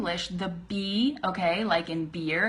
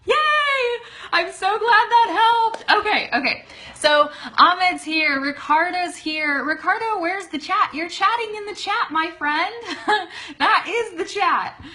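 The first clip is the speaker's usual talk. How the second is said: high, loud, laughing cheer; real excited squeal, breaking into laughs; delighted excitement; 10 semitones higher, 6 dB louder than usual. Real, not acted. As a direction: greet arriving friends with gleeful energy. Delighted greeting of live viewers.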